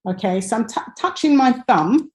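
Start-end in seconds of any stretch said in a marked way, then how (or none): none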